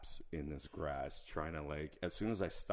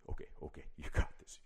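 whispering; 2 semitones lower, much faster than usual. Retold or imitated. Self-talk while tripping.

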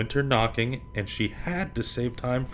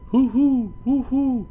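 high, loud hoo like an owl; owl hooting; 5 dB louder, slower than usual. Pretend. Reading to kids, hooting high like an owl. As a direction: hoot it out like an owl.

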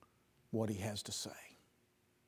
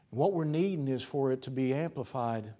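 normal talking voice

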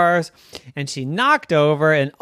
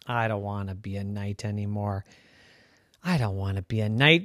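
softer and lower, weary; quiet giving up; 6 semitones lower, 6 dB quieter than usual. Acted. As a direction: mutter it, fed up. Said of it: "she said".

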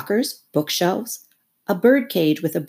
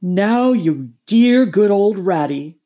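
loud, slow coaxing plea; imploring; 4 semitones higher, 6 dB louder, slower than usual. Acted. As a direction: wheedle your friend into agreeing. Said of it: "said the toad imploringly".

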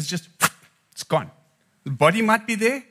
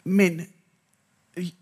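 usual speaking voice

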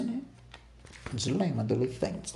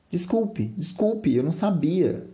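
high, pleading acted daughter; frantic apology; 8 semitones higher, 3 dB louder than usual. Daughter apologizing to her furious father, pleading. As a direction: beg forgiveness in a high, urgent voice.

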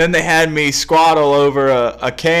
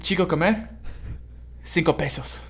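mock Spanish accent; 3 semitones higher, 8 dB quieter than usual. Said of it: "I was gonna be like".

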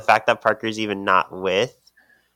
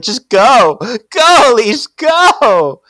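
much higher, louder desperate urging; dramatic shout; 13 semitones higher, 10 dB louder, much slower than usual. Acted. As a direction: cry it out like a melodrama heroine. Phoebe urging Elise dramatically.

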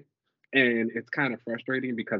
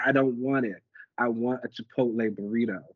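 ordinary talk

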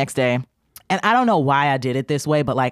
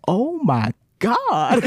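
higher, very slow drawn-out gasp of shock; imitating stunned boy; 6 semitones higher, much slower than usual. Confused brother reacting to the threat, slow and stunned. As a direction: let it stretch out in disbelief. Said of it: "He went".

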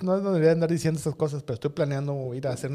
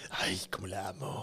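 low, soft lovesick sigh of a line; mock-tender cartoon voice; imitating Trino's line; 9 semitones lower, 13 dB quieter than usual. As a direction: croon it low and hushed like a smitten man. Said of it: "a qué se refiere cuando dice".

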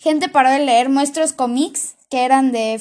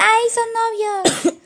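high, slow swoon over the fake couple; mock swooning gossip; 9 semitones higher, slower than usual. Girl mocking gossiping classmates with a high, slow swoon.

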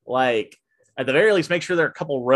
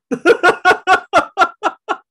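high, loud burst of laughter; real rapid-fire cackle of amusement; laughing; 7 dB louder than usual. Real, not acted. Teller cracking up over busting teens in theater, laughing.

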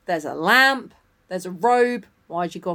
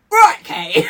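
high, loud mock yell; imagined outburst; 12 semitones higher, 10 dB louder than usual. Boyfriend upstairs once recording is done, mock outburst. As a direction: call it out in mock confrontation. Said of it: "he just has to sit there being like".

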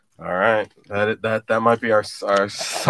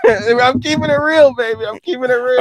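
laughing; 9 semitones higher than usual. Real, not acted. Teasing repeat of catchphrase.

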